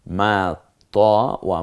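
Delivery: ordinary talk, nothing marked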